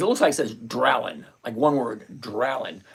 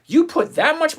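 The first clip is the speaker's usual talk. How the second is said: shocked what; 12 semitones higher, 7 dB louder than usual. Retold or imitated. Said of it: "I said".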